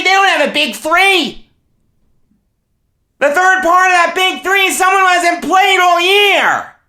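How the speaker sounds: high, shouted sports-fan outrage; furious rant. Real, not acted. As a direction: rant at the top of your pitch. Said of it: "I'm beyond furious".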